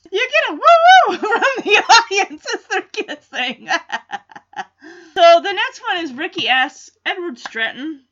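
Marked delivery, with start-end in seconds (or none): 0.1-3.8 laughing through the words, higher and louder; can't hold back the giggles as you tell it. Real, not acted. Recapping the kiss scene to viewers, laughing. Laughing while recounting audience.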